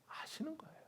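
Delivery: high breathy whisper. Real, not acted. Preacher confiding that Jesus knew, whisper. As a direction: let it slip as a hushed secret. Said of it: "whispered aside".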